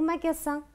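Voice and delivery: "magasin" with unvoiced s before the last vowel; S sound. Shown as a demonstration. Teacher repeats the wrong option to students, "magasin" with s. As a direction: hiss the middle of "magasin" like ss. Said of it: "qu'est-ce qu'on dit ici?".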